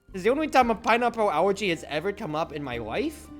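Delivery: normal talking voice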